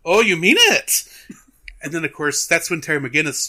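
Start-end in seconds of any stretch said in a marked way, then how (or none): 0.0-1.0 higher, louder cartoon comeback; mock perky surprise. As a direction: pipe up suddenly, imitating a cartoon rabbit. Bugs springs back to life, retold.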